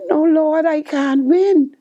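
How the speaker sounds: high, slowed plea to the Lord; pleading disbelief. Retold or imitated. Praying to the Lord after the win: high slow plea. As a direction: beg God it isn't true. Protesting to God after winning.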